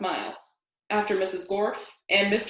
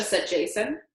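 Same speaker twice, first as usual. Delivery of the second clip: plain reading voice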